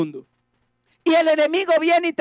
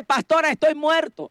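ordinary talk

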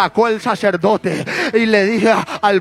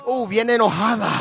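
ordinary delivery

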